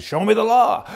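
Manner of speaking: heated challenge to the bank teller; heated demand. Retold or imitated. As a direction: push back hard at the teller. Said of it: "I'm getting kinda heated".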